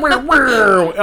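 high, loud whooshing radio sound effect; mock soundbite whirs. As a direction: make the cheesy radio stinger noise. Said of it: "soundbite, soundbite".

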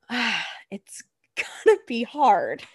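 slow, drawn-out hesitant sigh; mock reluctant girlfriend. Mocking Juliet pondering the pact, slow and reluctant. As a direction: stretch it out like she's weighing it. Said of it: "she literally says".

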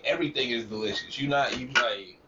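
ordinary talk

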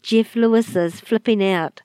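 usual speaking voice

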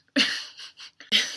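short stifled laugh. Real, not acted. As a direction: laugh at your own story. Laughs at the retold scene.

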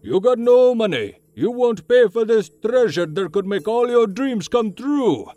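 put-on high, furious old shopkeeper yelling; angry old shopkeeper. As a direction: throw him out of the shop. Shopkeeper throws customer out.

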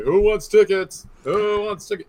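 loud slow street hawker call; pushy ticket tout. Retold or imitated. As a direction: bellow it like a scalper outside the arena. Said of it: "and be like".

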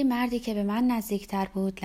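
ordinary delivery

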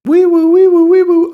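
high, loud wailing wee woo siren; mock police siren. Mouthing a siren as the police arrive, mock siren wail. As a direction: wail like a cop car siren.

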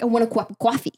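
New York accent with coffee said as cawfee. Shown as a demonstration. Explaining New York speech to a friend, New York accent. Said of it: "It's like".

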